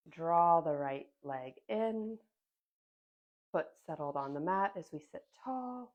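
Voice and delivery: ordinary delivery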